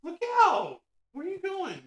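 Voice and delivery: high, alarmed calling after the dog; startled call to dog. Real, not acted. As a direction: catch the dog running off.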